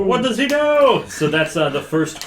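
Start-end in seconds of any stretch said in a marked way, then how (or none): 0.0-1.0 very high, loud echo of a cry; mock urgent outburst. Player echoing the hermit's outburst, mock urgent. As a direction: imitate a hammed-up alarmed shout.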